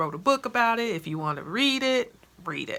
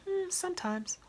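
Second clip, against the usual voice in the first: imitating blasé friend; 13 semitones higher, 4 dB quieter than usual. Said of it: "She was so blasé about it".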